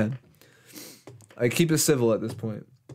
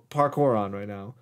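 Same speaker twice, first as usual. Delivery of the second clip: ordinary delivery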